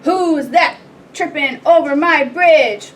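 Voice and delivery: loud, slow troll roar; big booming challenge, drawn out. A narrator's reading. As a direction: roar it out as a troll guarding his bridge. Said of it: "roared the troll".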